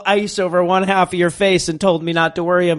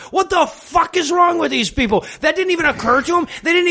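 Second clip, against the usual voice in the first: exasperated rant; 7 semitones higher than usual. Real, not acted. Host rants at the film's characters. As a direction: throw up your hands and yell it. Ranting about the movie.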